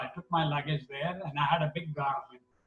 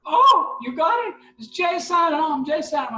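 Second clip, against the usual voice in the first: much higher and louder thrilled cry; wife's excited squeal; 11 semitones higher, 7 dB louder than usual. Retold or imitated. Husband imitating his wife's thrilled reaction on the phone. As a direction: shriek with delight like his wife. Wife hears the award news.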